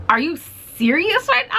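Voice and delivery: high, incredulous squeak; can't believe what she just heard; shocked disbelief. Retold or imitated. Retelling the bathroom slur, high disbelieving squeak. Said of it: "I was just shocked".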